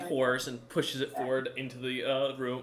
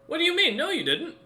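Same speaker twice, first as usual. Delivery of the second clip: high-pitched, raised-voice protest; incredulous objection; 5 dB louder than usual. Acted. Game master voices Bargis disputing the player's claim, incredulous. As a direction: object in disbelief, pitch leaping up.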